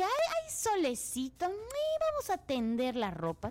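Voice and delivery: high, sweet singsong to the sun; sunny singsong to herself. Retold or imitated. Host retelling how she cheerfully went to hang laundry, high singsong. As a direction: coo at the sunshine as you head out to hang the washing. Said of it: "Y pues dije".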